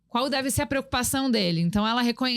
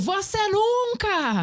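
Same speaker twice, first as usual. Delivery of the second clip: high, loud, slow accusing outburst; exasperated parent; 9 semitones higher, 3 dB louder, much slower than usual. Acted. Parent at the end of their rope yelling at child, exasperated. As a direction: drag out the blame like a fed-up parent.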